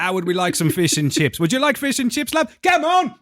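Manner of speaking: exaggerated British caricature ending in a high shout; mock British accent. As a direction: build from chatty to squawking British. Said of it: "I hear the accent".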